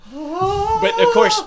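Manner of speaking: high, slow "of course"; sarcastic. Acted. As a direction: drag it out sarcastically. Sarcastic high drawn-out line.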